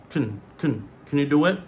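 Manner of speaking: "Can" squashed to kn; reduced form. Shown as a demonstration. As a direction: run "Can you" together quickly. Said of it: "Donc ça, c'est réduction".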